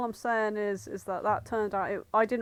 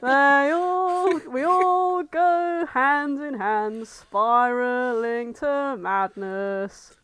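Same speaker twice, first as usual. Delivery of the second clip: slow, drawn-out, louder and higher; dragging each word toward madness; mock doom prophecy; 4 semitones higher, 6 dB louder, much slower than usual. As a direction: intone it like a hammed-up cultist. Eldritch horror bit climax.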